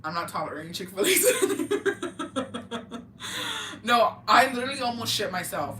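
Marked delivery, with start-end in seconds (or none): 1.2-3.8 real laugh at her own line; high laugh. Real, not acted.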